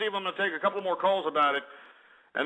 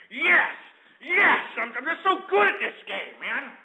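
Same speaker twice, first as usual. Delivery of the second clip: high-pitched triumphant crowing; gloating triumph; 6 semitones higher than usual. Real, not acted. Host celebrating a right guess, gloating and elated. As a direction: crow over your win, voice leaping up.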